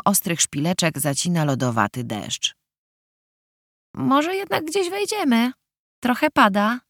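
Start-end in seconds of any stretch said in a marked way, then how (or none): 3.9-6.8 high pleading voice. Acted. Dagna prosi, by gdzieś wejść.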